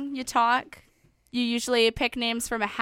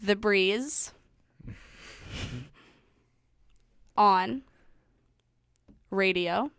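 syllable by syllable; 3 semitones lower, 3 dB quieter, slower than usual. Shown as a demonstration. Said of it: "Can you repeat it?".

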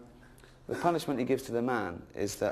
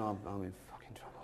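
much softer worried aside; fearful mutter; 4 semitones lower, 12 dB quieter than usual. Acted. Snake dreading God's punishment, soft worried mutter. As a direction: mutter it like a guilty pet. Snake thinking it's in trouble.

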